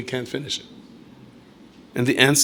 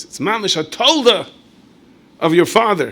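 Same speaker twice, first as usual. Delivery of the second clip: admiring exclamation; 8 semitones higher, 7 dB louder than usual. Acted.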